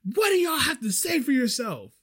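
high, indignant customer demand; mock yelling. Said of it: "She's, like, yelling at me".

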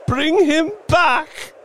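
very high, loud slow plea; desperate cry. Acted. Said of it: "cried the old woman".

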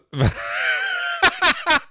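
shrieking laugh, close to crying; helpless high laughing fit; high squealing laugh. Real, not acted. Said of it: "oh Gott".

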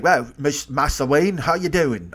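a bad, slow Cockney accent; Michael Caine. Comedian's failed Michael Caine, slow bad Cockney accent. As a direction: attempt a Cockney old scrapper, badly. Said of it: "I can't do Michael Caine".

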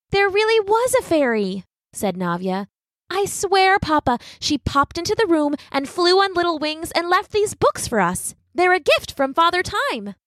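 put-on high, earnest child's voice; pleading little girl. A narrator's reading. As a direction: insist the fairy was real.

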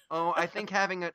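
ordinary talk

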